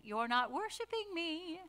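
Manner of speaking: high, soft singsong tease; playful high-pitched singy-songy complaint; singsong actress impression. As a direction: coo it high like the movie actress. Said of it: "high-pitched, singy, songy voice that this actress did".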